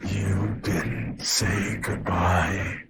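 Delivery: slow, choked creature voice mimicking a father. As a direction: drag each word out as if choking. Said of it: "The creature choked out my father's voice".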